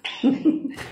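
bright surprised laugh; delighted laugh. Real, not acted. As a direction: burst of high delighted laughter. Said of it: "sing it again".

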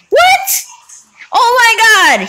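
high, loud yelp of shock; shocked outburst. Real, not acted. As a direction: exclaim in sudden, high-pitched disbelief. Reacts to shock in game.

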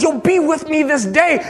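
high-pitched, ringing promise; fervent proclamation. Retold or imitated. Preacher retelling Jesus' promise to his dad, fervent. Same promise, retold with rising fervour.

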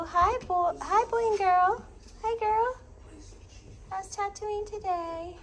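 high cooing puppy talk. Real, not acted. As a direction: coo up high at the babies. Cooing at puppies.

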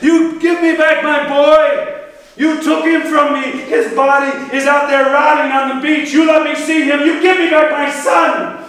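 put-on a father shrieking for his boy's body; furious shout. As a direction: scream it at your son's killer. Priam demands his son's body.

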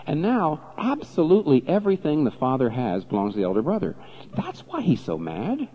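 usual speaking voice

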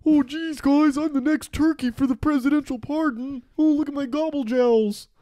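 high, hammed-up silly character voice; mock turkey politician.

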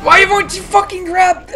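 real frustrated yell, high and loud; angry shout. Real, not acted. Player yelling at Seraph who won't grab, angry shout. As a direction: shout it at a game that won't obey. Raging at the game control.

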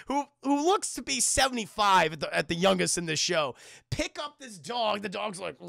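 ordinary talk

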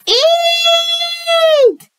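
very high, long squealed shriek; yelled eek. A narrator's reading. Second scaredy cat kid sees a monster, piercing squeal. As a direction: squeal "eek" in storybook terror. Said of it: "yelled Carrie".

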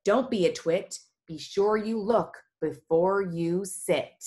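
ordinary delivery, nothing marked

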